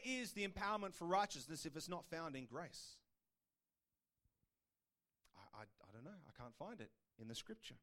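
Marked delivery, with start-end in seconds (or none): 5.4-7.8 feigned bafflement. Pretend. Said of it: "feigned confusion".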